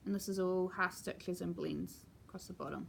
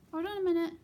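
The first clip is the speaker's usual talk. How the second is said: louder, a bit higher interruption; exclaim; 7 semitones higher, 7 dB louder, much faster than usual. Real, not acted. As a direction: break off as the cat gets in the way. Cat interrupts filming.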